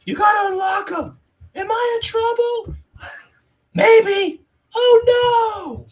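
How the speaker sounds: put-on high-pitched little boy voice; kid voice. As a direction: play the sheepish kid. [name]'s kid voice dialogue.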